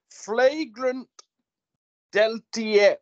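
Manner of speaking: mock French accent on the horse name; hammy French pronunciation of Flagrant Deltier. Shown as a demonstration. Tipster names a horse in French accent. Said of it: "In my best French accent".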